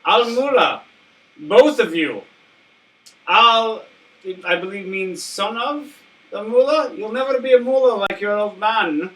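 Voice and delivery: lightly posh British colonial voice; hint of a colonial Brit, formal and loud; British colonial accent. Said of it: "I will try the British colonial".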